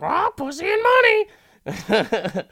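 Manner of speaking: shrill high squawk, slow and loud; squawking parrot. Retold or imitated. The parrot squawks when the rich guy grabs it, high and loud. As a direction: screech it like a parrot. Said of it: "he's like (the parrot)".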